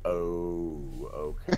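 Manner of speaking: low, drawn-out realization; guard catching on. Acted. Guard nudged by his colleague, slowly catching on. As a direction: stretch out a dawning oh. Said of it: "gestures over to the sorcerer's source".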